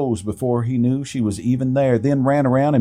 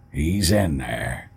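uncle; 8 semitones lower, 4 dB quieter, slower than usual. A narrator's reading. Old uncle speaking in story.

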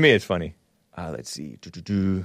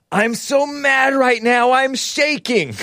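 high, slow, mock-trembling outrage; mocking a furious tweeter; mock outraged tweet; 12 semitones higher, 4 dB louder, slower than usual. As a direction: read it up high like a shaking hysteric. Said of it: "Tweet from".

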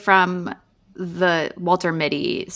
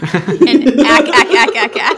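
Kathy's ack; 2 semitones higher, 10 dB louder than usual. Retold or imitated. Host imitating the cartoon Kathy's ack, loud and laughing. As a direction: bark out the comic-strip ack, laughing. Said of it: "Kathy's ack".

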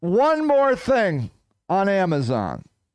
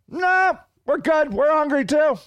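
a lightly higher smug hyena reply; 6 semitones higher than usual. Retold or imitated. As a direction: brush him off like a pack of scavengers. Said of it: "hyenas answering the lion".

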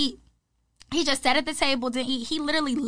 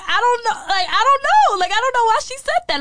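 high, loud, flustered insisting; baffled protest; 11 semitones higher, 7 dB louder than usual. Retold or imitated. Telling husband she had no idea why friend said it, flustered. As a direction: blurt it out high and loud, at a loss. Blurts baffled reply in retold kitchen scene.

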